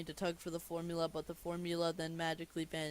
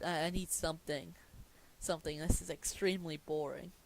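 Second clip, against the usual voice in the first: usual speaking voice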